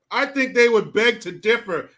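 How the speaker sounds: ordinary talk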